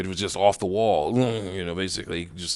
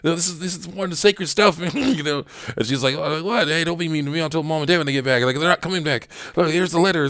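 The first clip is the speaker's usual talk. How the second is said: ticked off; 8 semitones higher than usual. Retold or imitated. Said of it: "she's ticked off".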